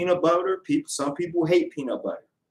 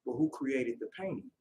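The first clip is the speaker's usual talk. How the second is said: sly quiet comeback; 2 semitones lower, 11 dB quieter than usual. Acted.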